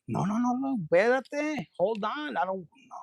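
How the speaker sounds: high, softened mock panic; acted-out nervous refusal, high and soft; scared protest. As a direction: back off in a high, half-playful fluster. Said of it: "I'm scared".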